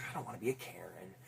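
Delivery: hushed, breathy whisper; a quick whispered joke; whispered aside. Retold or imitated. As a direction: whisper it like a confession. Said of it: "I'm like".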